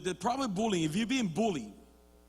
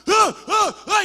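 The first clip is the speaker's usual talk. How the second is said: haka war cries; 12 semitones higher, 13 dB louder, slower than usual. Retold or imitated.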